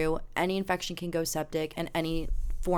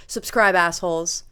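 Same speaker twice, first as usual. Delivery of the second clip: loud; 8 dB louder than usual. Acted. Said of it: "repeated sign-off, loud take".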